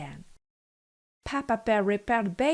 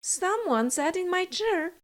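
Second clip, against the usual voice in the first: much higher, mild motherly voice; average Mama Bear voice; 8 semitones higher than usual. A narrator's reading. Mama Bear noticing her moved chair, higher voiced. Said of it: "said Mama Bear in her average voice".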